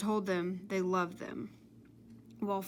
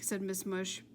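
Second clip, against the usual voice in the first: usual speaking voice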